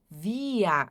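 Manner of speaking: via slow, long final a; drawn-out pronunciation. Shown as a demonstration. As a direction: stretch via out. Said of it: "a pronúncia". (via bem alongado)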